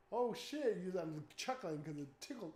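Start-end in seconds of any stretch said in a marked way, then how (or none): 0.1-0.8 loud, high shocked outburst; startled exclamation. Retold or imitated. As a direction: jump back in fright.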